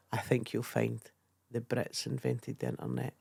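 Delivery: a faint hint of a plummy Englishman, said softly. Retelling the comic's retort to the heckler, mildly plummy. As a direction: lightly mimic a smug English toff. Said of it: "with a plummy English voice".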